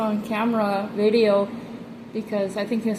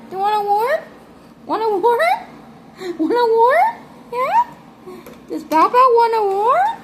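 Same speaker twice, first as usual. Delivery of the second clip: much higher, louder squeaky pet talk; 12 semitones higher, 4 dB louder than usual. Real, not acted. Owner offering her lizard a worm, high excited baby talk.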